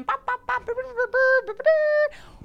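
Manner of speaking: high, loud galloping bops; war horse hoofbeats. Retold or imitated. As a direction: belt out the charging horse's hooves.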